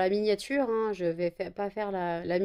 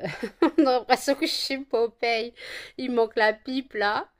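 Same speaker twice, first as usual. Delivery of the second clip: laughing; 4 semitones higher, 4 dB louder than usual. Real, not acted. Joking she looks like Popeye.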